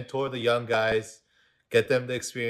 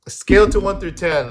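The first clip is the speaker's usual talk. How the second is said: loud; 9 semitones higher, 9 dB louder than usual. Real, not acted. Host prodding drunk friend.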